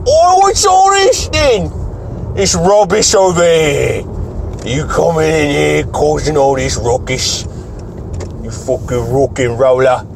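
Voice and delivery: cartoonish Cockney telling-off; Cockney accent. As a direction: ham up a complaining Cockney, starting high and drawn out. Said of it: "stop doing the Cockneyed accent around".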